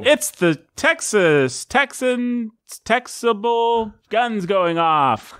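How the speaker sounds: slow, drawn-out segment announcement; mock announcer. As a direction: stretch each word with hammed-up showmanship.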